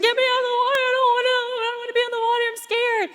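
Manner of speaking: put-on high, frightened little girl pleading; scared child crying out. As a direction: imitate a terrified child begging to get out. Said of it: "she starts screaming and crying".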